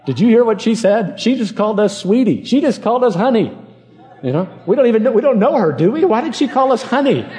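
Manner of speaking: high-pitched imitation of his children; astonished kids. Kids pointing out waitress's talk to Dad, mock astonished. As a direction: play a puzzled kid, pitched well up.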